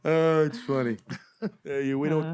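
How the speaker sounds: loose, shaky attempt at Chicago; Chicago guy accent. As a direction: play a Chicago local, lightly accented. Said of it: "I'm doing a Chicago guy".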